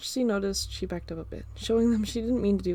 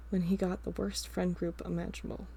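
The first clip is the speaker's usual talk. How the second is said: usual speaking voice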